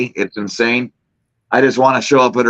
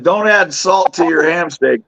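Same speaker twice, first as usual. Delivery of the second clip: ordinary delivery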